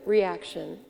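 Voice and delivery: ordinary talk, nothing marked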